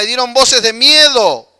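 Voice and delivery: normal talking voice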